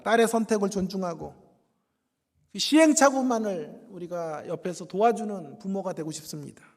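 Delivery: usual speaking voice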